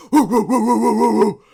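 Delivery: rapid string of loud, high woos; rowdy whooping. Acted. Dwarves hear bar crawl mentioned, rowdy whooping. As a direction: hoot like an excited drinking gang.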